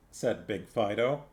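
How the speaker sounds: ordinary delivery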